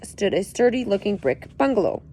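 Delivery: plain reading voice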